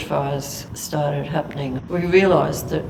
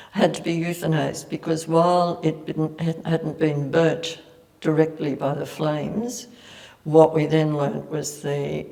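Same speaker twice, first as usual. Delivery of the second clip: normal talking voice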